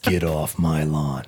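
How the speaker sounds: put-on low, slow grumbling old man; slow grumpy old man voice. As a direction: drag it out like a cranky grandpa. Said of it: "Grumpy old man".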